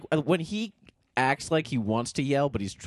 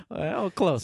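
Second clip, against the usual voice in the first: ordinary delivery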